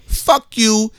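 ordinary talk, nothing marked

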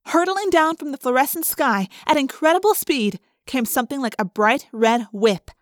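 ordinary delivery, nothing marked

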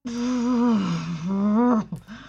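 long, loose blown-out breath; imitated exasperated noise. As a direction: blow out a long wordless reaction. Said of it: "one moment where I was like".

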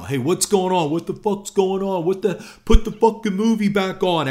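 high, loud heckling from the seats; angry crowd yelling. Retold or imitated. Moviegoers yelling at staff for stopping the film, angry shouts. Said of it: "people are already starting to yell".

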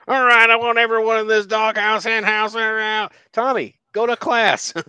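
high, loud call to the crew; shouting rowing coach. Acted. Coach orders Tommy out at Harvard, high hammed-up shout. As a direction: bellow orders like a college coach.

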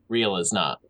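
normal talking voice

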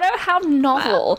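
high, slow mock-posh British exclaim; old-timey British accent. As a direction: gasp it like a Victorian lady, laughing. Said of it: "hear it in an old-timey British voice".

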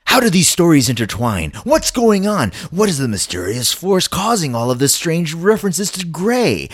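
high, loud trailer questions; dramatic teaser. Acted. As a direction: build suspense like a TV trailer.

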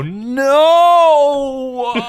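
slow-motion no. Acted. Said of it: "you see my eyes just go".

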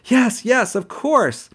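high, loud squeal of joy; squealing yes. A narrator's reading. Girlfriend squeals yes to her kneeling boyfriend. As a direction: shriek it out, overjoyed. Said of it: "She let out a squeal and screamed".

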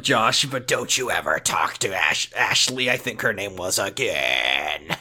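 low, slow mock stern father. [name] warning Josh, low mock stern father voice. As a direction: growl the warning like a dad.

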